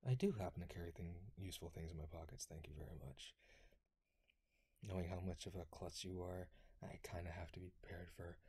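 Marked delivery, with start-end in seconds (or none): 2.4-3.3 soft whisper with a teasing edge; whispered thanks. Acted. Whispering thanks to a teasing friend, quietly. 6.8-8.4 a soft, confiding whisper; whispered aside. Acted. Whispering to a klutzy friend about being prepared. Teasing friend in close roleplay.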